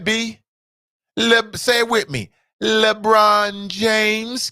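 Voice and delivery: high, drawn-out, teasing Shannon Sharpe voice; Shannon Sharpe impression. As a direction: stretch every name out slow and high, taunting Brian. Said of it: "That's my Shannon Sharpe impersonation".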